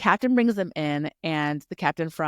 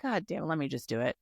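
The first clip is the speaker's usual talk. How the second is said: softer and fast, fed up; fast exasperated mutter; 6 dB quieter, faster than usual. Retold or imitated. Ark fed up with Dane's translation, fast soft grumble. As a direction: grumble it under your breath, hurrying. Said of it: "he's like".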